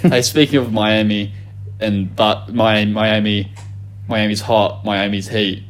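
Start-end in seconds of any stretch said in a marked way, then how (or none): none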